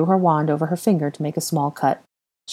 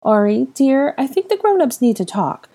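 put-on higher, sweet coaxing voice to a child; pleasant offer; 8 semitones higher, 3 dB louder than usual. Molly coaxing the boy to the kitchen, sweetly. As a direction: gently coax the boy toward a snack. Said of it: "Molly offered pleasantly".

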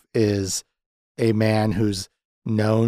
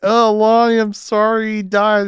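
high, louder hammed-up apology; mock sorrow; 10 semitones higher, 4 dB louder than usual. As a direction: overplay the sympathy up high. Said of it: "and he's just like".

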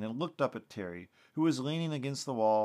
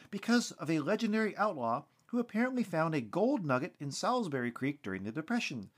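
higher-pitched local news report voice; TV field reporter; 6 semitones higher than usual. Acted. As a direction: deliver it as an on-the-scene reporter.

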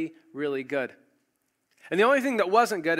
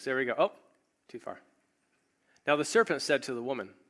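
ordinary delivery

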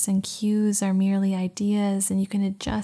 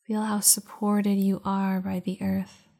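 guided meditation. Acted. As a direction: guide them into calm, unhurried. Leads a meditation.

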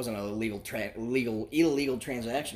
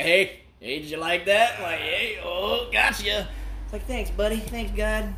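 playful heaven exchange; 8 semitones higher, 5 dB louder than usual. Pretend.

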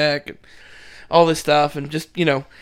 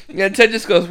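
usual speaking voice

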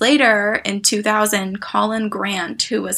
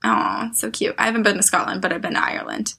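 ordinary talk

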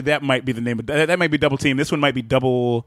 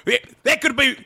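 wrestling commentator; 8 semitones higher, slower than usual. Pretend. Commentator bit.

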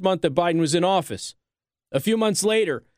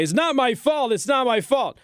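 higher, whiny mock of the president; mock Biden whine; 6 semitones higher than usual. Host mocking Biden's excuses, higher whiny voice. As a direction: mimic a blame-dodging whine. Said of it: "as Joe Biden continues to go around and say".

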